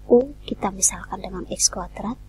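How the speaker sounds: ordinary talk, nothing marked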